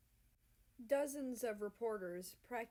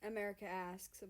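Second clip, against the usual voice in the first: plain reading voice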